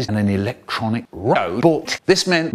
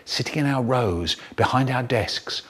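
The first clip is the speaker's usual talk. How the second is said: ordinary delivery, nothing marked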